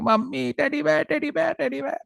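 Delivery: very high mock wailing; crying little girl. Child sitting on the ground begging mother, high crying whine. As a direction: imitate a child's squealing, repetitive tantrum. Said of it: "she started crying".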